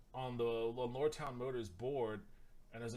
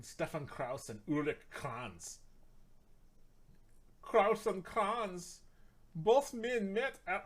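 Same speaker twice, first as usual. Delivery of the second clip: slow mock German accent, growing higher and louder; 6 semitones higher, 5 dB louder, slower than usual. Vlogger keeps reading the founders in mock German accent. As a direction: drag the names out in bad German. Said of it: "I gotta stop doing my German".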